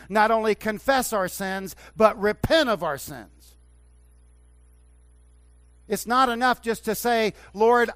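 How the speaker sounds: loud, high, full-force revival preaching; fiery Southern preaching. Acted. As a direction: preach it like an old Southern Baptist pastor. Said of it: "I'm sounding like an old Southern, Southern Baptist pastor".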